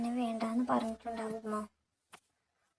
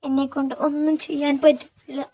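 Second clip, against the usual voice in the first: put-on louder tearful lament, old man begging forgiveness; old father, loud and grieving; 3 semitones higher, 10 dB louder than usual. A narrator's reading. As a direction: pour out his guilt, close to tears. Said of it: "തോളിൽ കിടന്ന് തോർത്തുകൊണ്ട് ... രണ്ട് കണ്ണുകളും തുടച്ചു".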